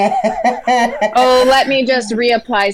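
amused, hammed-up reply; laughing mock-vain comeback. Woman joking back at co-host's women-in-office tease, laughing. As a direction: play it up through laughter.

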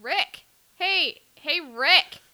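high, insistent calling out; mock holler. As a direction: pester loudly with a running-joke yell. Mimicking the yelled quote.

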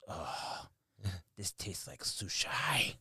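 hushed, slow whisper of disgust; whispered kid disgust. Retold or imitated. Mother retelling her son tasting sushi water, whispered disgust. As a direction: whisper it like a grossed-out child. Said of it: "he goes".

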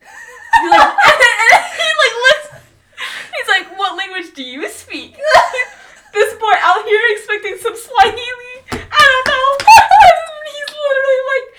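high, loud laughing talk; laughing hard. Real, not acted. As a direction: crack up mid-story. Laughing hard while retelling.

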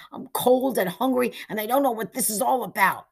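put-on a scared boy whining, quick at the end; frightened boy's high whine; wailing. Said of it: "frankly wailing".

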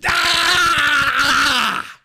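long, high, loud "aah" scream; hammed-up scream of fan excitement; overexcited scream. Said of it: "Got a little bit juiced up".

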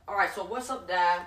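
chummy seller working on the dad; street hawker. Retold or imitated. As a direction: act out a pushy greeting from a street seller. Seller turns to the father.

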